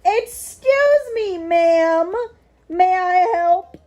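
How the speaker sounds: loud, high, slow mock-formal greeting; loud exaggerated courtesy toward the cat, breaking into a laugh; mock politeness. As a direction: address the cat like a fussy shop clerk.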